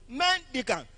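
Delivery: "Mendicant" with MEN high; emphatic "Mendicant", falling away after MEN; first-syllable stress. Shown as a demonstration. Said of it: "As I pronounce them".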